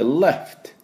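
left with a light L and a firm F; English pronunciation. Shown as a demonstration. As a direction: say left with a crisp F, not レフト. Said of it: "Fしっかり".

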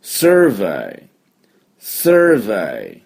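survey said clearly with a rounded sir sound for students to repeat; survey pronounced in native English as a model; model pronunciation for repeating. Shown as a demonstration. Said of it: "私の後について発音しましょう". (復唱用にsurveyをはっきり発音)

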